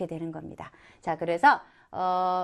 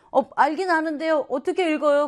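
higher, louder student voice; puzzled student; 6 semitones higher, 4 dB louder than usual. Acted. Student asks teacher how to read a speed, puzzled higher voice. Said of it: "그러면 안 되잖아요".

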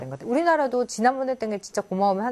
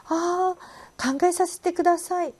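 hesitant stalling; 10 semitones higher, slower than usual. Acted. Acting out a polite stalling refusal.

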